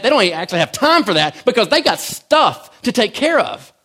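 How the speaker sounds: building sermon fervour; preaching. Acted. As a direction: preach it to the congregation with force.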